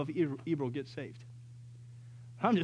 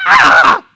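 high, loud exasperated shriek; breathy loud yell of frustration; frustrated scream; 21 dB louder than usual. Retold or imitated. Frustrated scream in parking lot.

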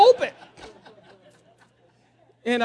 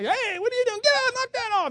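high, indignant shout at a trespasser; indignant attorney; 12 semitones higher, 3 dB louder than usual. Retold or imitated. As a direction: bark at him to get off your land.

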